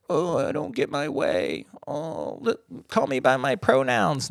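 soft, whiny mock of a complainer. Podcaster mocking critics who whine, in a soft sneering voice. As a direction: sneer it out as a sulky crybaby.

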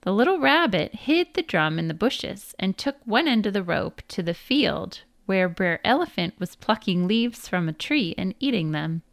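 plain reading voice

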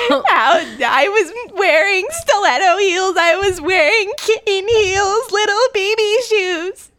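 very high, slow, wailing whine; hammed-up sobbing indignation, words stretched out; mock tearful quote. Co-host quotes complaint about heels, mock tearful. Comic impression from Bling Ring quote.

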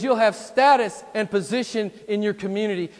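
loud, high sales pitch from the tempter; Satan tempting. Acted. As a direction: sell the temptation at full voice. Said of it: "Can't you hear Satan".

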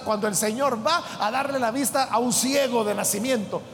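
ordinary talk

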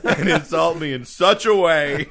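loud, laughing fake outrage; mock offended. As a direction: play at being deeply insulted.

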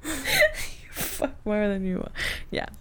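wheezing laugh through the words. Real, not acted. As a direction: crack up, barely getting words out. Cracking up at a joke.

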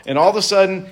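usual speaking voice

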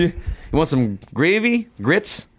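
high, drawn-out Tennessee twang; Tennessee accent. Retold or imitated. Waitress in Nashville offering gravy and grits, Tennessee accent. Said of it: "vraiment, là, Tennessee".

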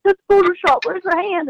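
high, whiny mock of a doubter; mocking commenter. Guide mimicking a commenter who calls her catch fake, in a high mocking whine. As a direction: parody the skeptic as a silly whine. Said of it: "'Cause they're like".

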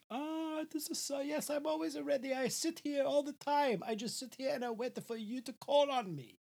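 put-on crooning old Italian charmer, laying it on thick; Mister Del Rossi. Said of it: "I gotta get the old man in here".

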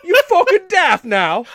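put-on slow, high, loud laughing English accent; mock English accent. Host hamming up the worst English accent. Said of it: "the worst accent possible".

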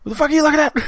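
fast, high squeaky challenge; tough dog voice. Pretend. Voicing tough dogs.

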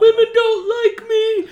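put-on high, loud, sobbing wail of a rejected drunk; mock bawling wail. Drunk guy at the bar wailing to the teller, a high mock sob. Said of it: "He's like".

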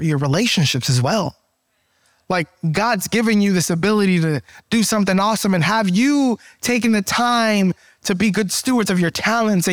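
usual speaking voice

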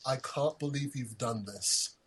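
soft, slow imitation of a British guy; mock British accent, very understated upset. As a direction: quietly imitate a put-out Brit, drawn out. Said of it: "I want you speaking a British accent".

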